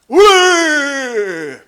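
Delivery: loud high woo; loud whoop. Retold or imitated. Naked comic whooping at his wife, loud and high. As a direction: whoop like a show-off clown.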